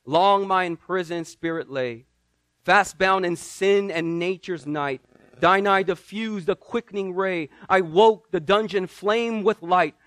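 slow, swelling recital of a hymn verse; hymn recitation. Acted. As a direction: build each line toward triumph.

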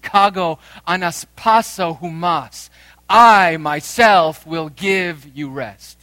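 slow, booming emphasis on I myself; emphatic. Shown as a demonstration. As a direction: hammer home the promise. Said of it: "emphatic in Greek".

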